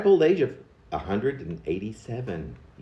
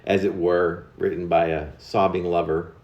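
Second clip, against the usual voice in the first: ordinary delivery, nothing marked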